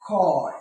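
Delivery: খয় said as "khoy" with a single kh; demonstrated pronunciation. Shown as a demonstration. Teacher showing word-initial kkha, খয় as plain "khoy". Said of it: "তাই এটার উচ্চারণ হবে".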